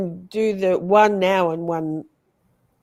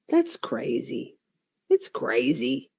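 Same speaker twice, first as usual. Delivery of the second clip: slow deadpan; 6 semitones lower, 5 dB quieter, slower than usual. Acted. Mock disbelief at a vote.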